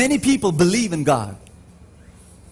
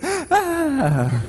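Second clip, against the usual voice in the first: sudden high shocked cry; crowd's startled gasp; 13 semitones higher than usual. Retold or imitated. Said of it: "everyone who's cheering".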